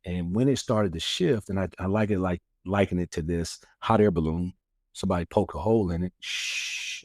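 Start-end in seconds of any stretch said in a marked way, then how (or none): 6.2-7.0 long "shh" of escaping air; hissing air leak. Retold or imitated. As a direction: hiss like a punctured balloon. Imitates air leaking from balloon.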